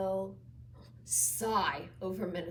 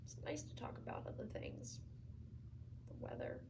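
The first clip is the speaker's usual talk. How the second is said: very soft, mostly whispered confession; faint, weary near-whisper; hushed regret; 3 semitones lower, 13 dB quieter, faster than usual. Acted. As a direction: barely voice it, with long pauses. Patient's regretful confession to visitor.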